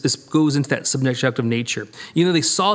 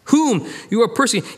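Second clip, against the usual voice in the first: whom punched and higher; stress on whom; 6 semitones higher, faster than usual. Acted. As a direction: lean hard on the word Whom. Repeats correction.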